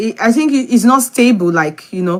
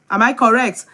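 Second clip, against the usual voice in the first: ordinary talk, nothing marked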